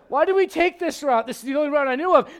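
high, loud, exasperated nagging; hammed-up marital bickering. Mary and Joseph quarrel over the route, hammed-up exasperation. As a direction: play a fed-up spouse on a miserable trip.

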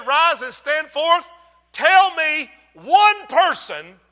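slow, high, ringing challenge; emphatic preaching. Real, not acted. Preacher challenging the church to name one person, emphatic preaching. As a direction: draw the words out loud for the whole church.

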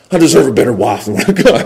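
low, quick, soft grumble; hushed fast griping; muttered complaint. Acted. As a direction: mutter it quickly under your breath. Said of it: "We're not saying it out loud".

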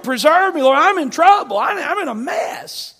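loud, high desperate plea to God; pleading cry. Retold or imitated. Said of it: "He's like".

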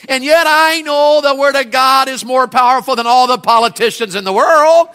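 high, loud preacher's cry; preaching at full force. Real, not acted. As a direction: build it up to the church. Preaching climax.

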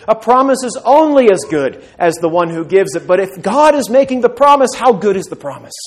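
loud, high, driving sermon voice; a pastor building to a climax; full-force preaching. Acted. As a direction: preach it out with rising fire.

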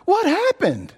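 high, baffled double-take; stunned what happened; bewildered. Retold or imitated. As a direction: ask it in disbelief, voice jumping up. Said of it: "And it's like".

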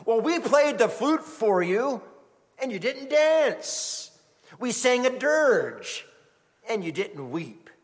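high, sing-song teasing, slow at the start; mock playground taunt; children's taunt. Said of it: "would tease them".

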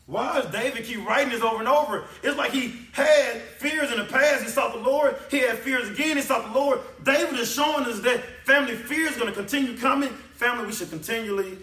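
high, loud sermon climax; impassioned preacher in full cry; full-force preaching. Real, not acted. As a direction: preach it loud, pitched well up.

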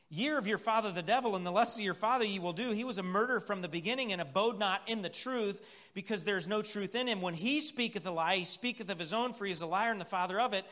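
fast, run-on Bible reading; rapid scripture reading. Real, not acted. Preacher reading Jesus's rebuke to the congregation, rapid recitation. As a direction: rattle through the verse without lingering. Preacher reads verse quickly aloud.

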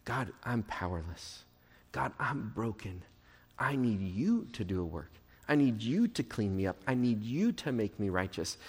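soft, low plea to God; humble prayer. Acted. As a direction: confess your need humbly, starting very quiet. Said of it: "I come humbly to him".

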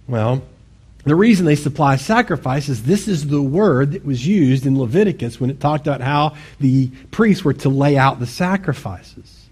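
usual speaking voice

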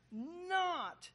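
"not" punched high and loud; emphatic not. Shown as a demonstration. Preacher explaining the Greek, stressing "not" hard. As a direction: lean hard on the word "not". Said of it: "is emphatic in the Greek".

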